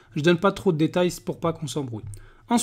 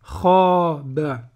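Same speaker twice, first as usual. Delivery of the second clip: kha drawn out slowly; slow blending; 3 dB louder than usual. Shown as a demonstration. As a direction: hold kha long and slow. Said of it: "si on fait le tout".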